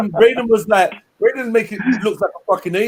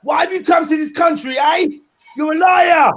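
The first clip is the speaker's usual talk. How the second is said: imitating the preacher; 8 semitones higher than usual. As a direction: ham up the ranting preacher's interrogation. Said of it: "Why are you talking like that?".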